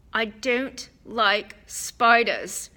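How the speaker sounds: slow, tense and uneasy. A narrator's reading. Boy admits to friends he fears spiders, tense and slow. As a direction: hold back the urge to run, slowly. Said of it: "said Ron tensely".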